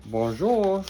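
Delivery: high, bright French-style bonjour; French greeting. Acted. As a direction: greet like a little Parisian. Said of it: "In French, that means hello".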